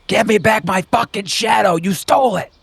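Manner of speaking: very high shrieked yell from down the road; distant shriek. Acted. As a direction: scream it far off like a madwoman. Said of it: "you hear".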